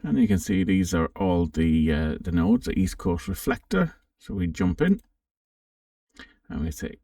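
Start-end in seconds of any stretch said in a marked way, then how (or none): none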